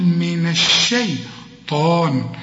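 من الشيطان slow, thin shin and a heavy ta; heavy taa only. Shown as a demonstration. Teacher modelling الشيطان for the student. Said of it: "الطاء هي المفخمة".

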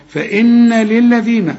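فإن للذين with a long, light N; light held N. Shown as a demonstration. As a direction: slowly hum the N of فإن before للذين. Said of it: "النون بقى جات لنا إزاي مرققة".

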